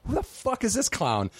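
high, incredulous exclamation; baffled disbelief. Retold or imitated. Reading the magazine, stunned disbelief. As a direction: react like you just saw a stranger's photo.

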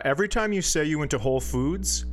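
ordinary talk

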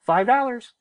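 put-on high, small voice of a boy actor; little kid voice. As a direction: chirp it like a tiny kid calling out a fine. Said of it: "you'd hear this little voice say".

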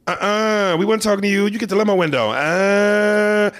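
high, sassy put-on voice. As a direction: shut the cab driver down. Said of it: "we both went".

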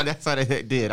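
ordinary talk

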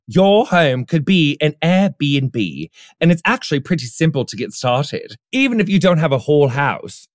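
British voice. Pretend. Host hamming up an Airbnb ad read, British voice. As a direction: play it up as a posh-ad British accent. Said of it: "I was doing my British voice earlier".

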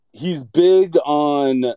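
ordinary delivery

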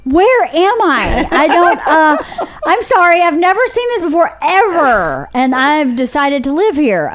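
mock stunned disbelief; shocked newcomer. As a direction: gasp it out like a wide-eyed small-town girl. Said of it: "I was like".

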